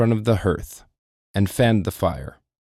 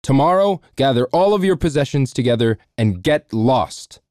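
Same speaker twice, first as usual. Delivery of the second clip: louder, higher angry order; angry dismissal; 4 semitones higher, 3 dB louder than usual. Acted. Lord angrily dismisses the cook, loud. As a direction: throw him out in a temper. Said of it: "yelled the lord".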